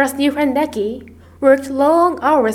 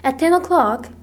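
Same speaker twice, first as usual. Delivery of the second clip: ordinary delivery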